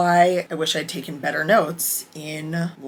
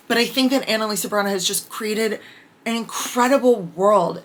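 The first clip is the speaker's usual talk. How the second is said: normal talking voice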